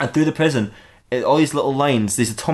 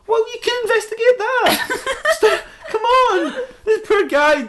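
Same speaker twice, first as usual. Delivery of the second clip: very high squeal of objection; hammed-up exasperated squeak; whining protest; slower than usual. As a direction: plead in a squeaky, laughing whine. Batman protests, with laughter.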